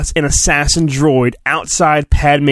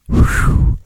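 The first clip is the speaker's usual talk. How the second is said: relieved whew. Acted. Relief at narrated escape.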